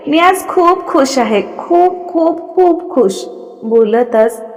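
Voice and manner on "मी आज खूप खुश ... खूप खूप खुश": higher, slow, overflowing with happiness; slow, high and tearful with joy. Acted. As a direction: stretch each खूप, close to tears. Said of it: "वीणा परत रडायला लागली".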